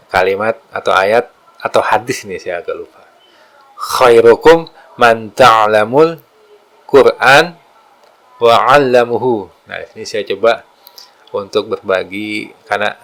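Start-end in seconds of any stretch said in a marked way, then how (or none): 3.8-9.5 hadith recitation. Acted. Quoting a hadith.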